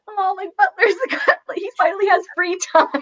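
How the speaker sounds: high, excited squeal through laughter; delighted. Retold or imitated. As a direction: gush about Butler with glee. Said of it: "I'm like".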